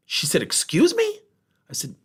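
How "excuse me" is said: indignant surprise. Retold or imitated. Boss reacting in disbelief.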